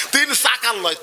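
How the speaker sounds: normal talking voice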